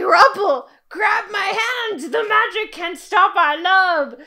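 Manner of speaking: high, loud melodramatic plea; mock soap-opera heroine cry; hammed-up damsel. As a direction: overact the desperate lover reaching out. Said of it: "she's just like".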